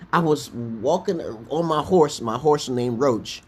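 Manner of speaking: ordinary talk, nothing marked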